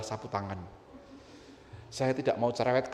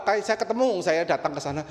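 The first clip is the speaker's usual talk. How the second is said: ordinary talk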